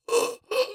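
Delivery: sharp high gasp for air; winded gasp. Retold or imitated. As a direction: gasp like the wind's knocked out. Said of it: "was like".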